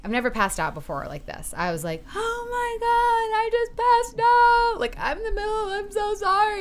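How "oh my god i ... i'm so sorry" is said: panicked wail. Retold or imitated. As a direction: wail it as if just waking from fainting. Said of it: "I just start screaming".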